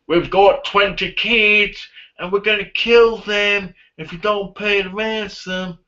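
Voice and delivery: high, slow put-on ransom caller; stretched, hammed-up ransom demand; mock kidnapper. Imagined kidnappers' ransom call.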